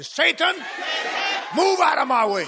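high, loud shout at the devil; booming pulpit command. Shown as a demonstration. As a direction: order Satan out with full preacher's force. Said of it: "I tell you what I would do".

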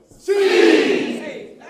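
one high ringing word; shouted answer. Real, not acted. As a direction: call the answer out long and high. Preacher giving the one word.

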